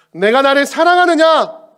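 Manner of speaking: high, loud, slow question; Jesus asking a third time. Acted. Jesus asks Peter the third time, loud and slow.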